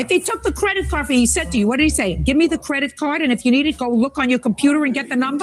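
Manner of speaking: high, fast sarcastic grilling; mock the excuse, scolding. Real, not acted. Judge sarcastically voicing the father.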